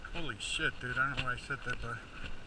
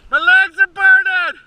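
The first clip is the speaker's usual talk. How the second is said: loud, slow, high strained cry; strained shout; 6 semitones higher, 5 dB louder, slower than usual. Real, not acted. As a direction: yell it out, spent from the climb. Cries out near the top.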